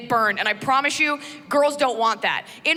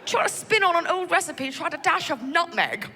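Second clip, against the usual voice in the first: British gent; 6 semitones higher than usual. Retold or imitated. Husband to wife, mild prim British. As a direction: offer it mildly, very proper. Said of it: "the guy, like".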